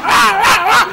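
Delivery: very high, loud imitated baby cry; mock baby wail. Imitating the baby screaming in his arms, mock wail. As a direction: wail like a crying toddler.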